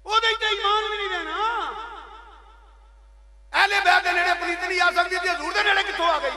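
loud, high-pitched full-force preaching shout; real sermon shout at full volume; impassioned preaching. Real, not acted. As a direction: thunder the warning to the congregation. Preacher thunders at congregation.

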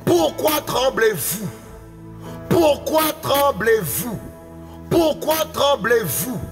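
ordinary talk, nothing marked

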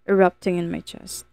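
ordinary delivery

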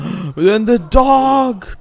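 gasp, then a high, loud, drawn-out cry; rising, stretched-out yell after a gasp; excited outcry. Real, not acted. Player exclaiming over the dog, excited outcry.

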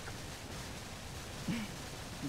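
near-silent voiceless giggle held back; stifled laugh. Real, not acted. Streamer stifles a voiceless laugh because he can't laugh loud. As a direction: laugh without letting sound out. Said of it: "I can't laugh loud".

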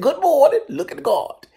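high and loud, a thrilled hello; excited high greeting. Retold or imitated. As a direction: greet him like you're starstruck. Gushing greeting to bodyguard.

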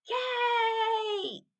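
very high, loud, held-out shout; gleeful cheer. A narrator's reading. Children cheering as the giant gets cake, gleeful shout. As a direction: cheer it at full voice.

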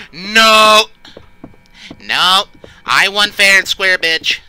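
loud triumphant yelling; shouting. Real, not acted. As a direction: shout it out in relief. Chased, escapes at the last second.

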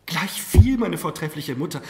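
high, loud, eager rejoinder; impassioned dismissal. A narrator's reading. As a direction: brush the warning aside eagerly. Marquise brushes aside mother's warning.

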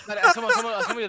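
laughing. Real, not acted. Called to peek, laughing.

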